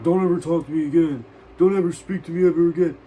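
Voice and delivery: loud, high, furious yell; angry yell. Retold or imitated. Kid screaming at the narrator in anger. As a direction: throw it back at me in a rage.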